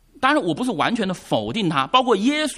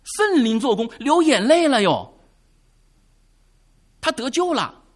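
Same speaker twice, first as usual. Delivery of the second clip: rising, hammed-up preacher excitement; mock the evangelist, climbing ever higher; 7 semitones higher, 5 dB louder than usual. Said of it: "这个传福音说".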